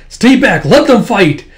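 high, loud battle shout; urgent command. Acted. Tuya warning others off the fight, urgent shout. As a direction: order everyone back over the fighting.

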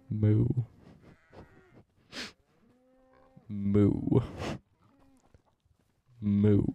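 low, lazy deadpan moo; a half-hearted cow impression; mock cow moo. Streamer mooing as a cow.

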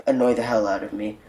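ordinary talk, nothing marked

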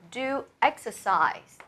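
"do exercise" slow, with a falling end; slow, final falling tune on "do exercise"; confirmed answer. Shown as a demonstration. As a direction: settle the answer, drawing out DO EXERCISE and dropping the tune. Confirms the right answer after options.

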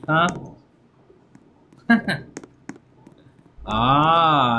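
normal talking voice

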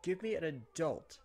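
high, drawn-out cry for help; mock distressed plea. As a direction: stretch it out, demanding a grown-up. Mock plea on the bullied boy's behalf.

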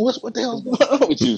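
mock exasperated, very high voice; disappointed complaint. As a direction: imitate a let-down friend demanding answers. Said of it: "he was disappointed".

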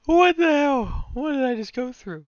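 a strained, squeaky cry of disbelief; exasperated disbelief. Real, not acted. After a hard ranking round.

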